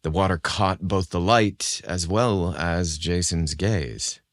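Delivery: plain reading voice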